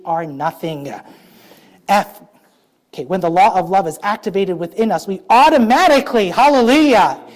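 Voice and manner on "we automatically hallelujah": louder, higher, rising praise; fervent preacher's cry; exultant hallelujah. Real, not acted. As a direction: burst into praise. Preaching climax.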